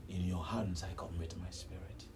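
low, very soft last words; dying surrender. Acted. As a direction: let it out low and hushed, life ebbing away.

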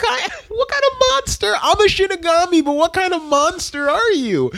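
high, incredulous mock outrage; Ryuk. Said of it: "And Ryuk's like".

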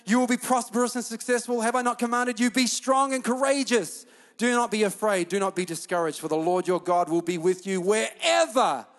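loud, commanding preacher's recitation; declaimed scripture. Acted. Pastor reciting God's charge to Joshua, forceful declamation. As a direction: proclaim it as a charge from God.

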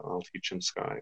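softly sung teach him sky; hushed quote. Retold or imitated. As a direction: breathe it out in awe. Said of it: "when she says that".

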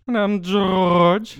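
loud, high, stretched-out announcement; hammed-up intro. Co-host introduces himself on the podcast, hammed-up holler. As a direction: belt out your name, dragging it long.

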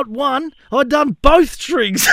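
high, loud, slowing on both strings; mock panic as it dawns on him; retold alarm. Retelling his panicked realisation.

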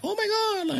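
high-pitched mock gasp of shock. Retold shocked reaction to baby.

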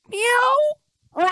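high, loud imitated meow; loud mock cat meow. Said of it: "And he goes".

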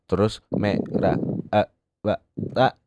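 words cut off mid-syllable; bad connection. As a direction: fake a breaking phone line. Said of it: "suaranya putus-putus".

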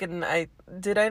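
ordinary talk

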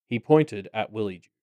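plain reading voice

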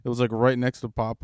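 insistent. Real, not acted. Friend insists to a doubting buddy it really happened, loud and insistent. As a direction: insist loudly so he'll believe you.

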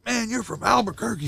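heckler from the crowd. Retold or imitated. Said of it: "Somebody in the crowd goes".

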